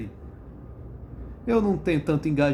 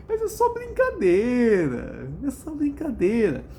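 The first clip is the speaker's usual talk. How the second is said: mocking; 6 semitones higher, slower than usual. Mocking the excuse.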